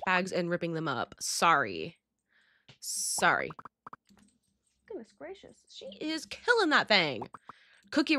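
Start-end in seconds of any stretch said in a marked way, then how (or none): none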